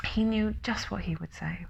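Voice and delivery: hushed narrator whisper. A narrator's reading. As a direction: let it drop to a whisper.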